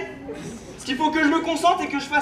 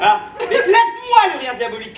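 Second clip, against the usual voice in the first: laughing villain; 7 semitones higher, 7 dB louder than usual. Acted. Claims the evil laugh.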